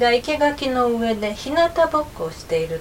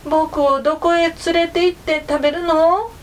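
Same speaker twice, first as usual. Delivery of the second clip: frightened rooster; 7 semitones higher, 3 dB louder than usual. A narrator's reading. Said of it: "おんどりは走っている狐に言いました".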